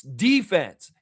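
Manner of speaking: ordinary talk, nothing marked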